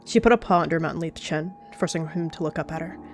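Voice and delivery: ordinary talk, nothing marked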